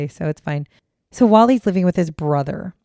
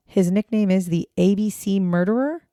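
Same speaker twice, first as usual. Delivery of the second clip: ordinary talk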